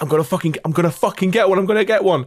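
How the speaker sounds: rising, eager self-hype; psyching yourself up for the nuke; excited. Retold or imitated.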